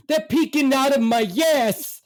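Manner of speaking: very high, loud mock shout; shouting mother. Mother to dad from the bench, desperate shout. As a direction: imitate a desperate mother yelling back. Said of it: "She goes".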